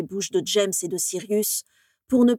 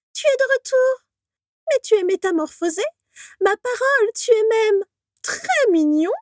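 cooing ghost; 5 dB louder than usual. Acted. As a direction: coo over him like a lovestruck ghost girl. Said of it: "roucoula-t-elle".

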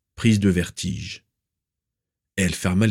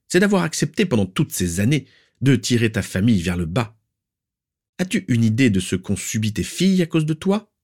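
higher-pitched, relentless tirade; implacable accusation; 6 semitones higher than usual. A narrator's reading. Aunt blames Druella for her daughters' misery, implacable. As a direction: pile up the reproaches, indignant questions rising.

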